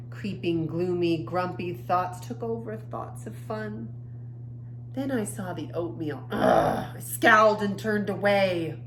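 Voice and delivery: lively, expressive picture-book reading; reading to children. A narrator's reading. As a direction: read the story to young kids with feeling. Reads picture book aloud.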